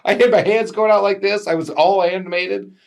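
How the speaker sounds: high, giggly recollection; laughing at himself, pitched high. Real, not acted. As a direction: laugh through the memory. Laughing recall of own animation.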